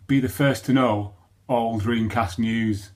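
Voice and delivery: loud, slow slogan reading; big, deliberate advert line; promotional read-out. Acted. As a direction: announce the slogan loudly, stretching it out. Reads out promotional blurb from screen.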